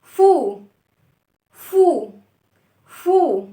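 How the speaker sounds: "فو" said loud with rounded lips; loud teaching model of "فو"; fu sound demo. Shown as a demonstration. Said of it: "اسمع كده".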